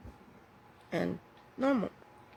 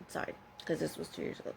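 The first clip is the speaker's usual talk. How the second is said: whispered aside; 3 semitones lower, 7 dB quieter, faster than usual. Real, not acted. Quick whispered aside correcting herself.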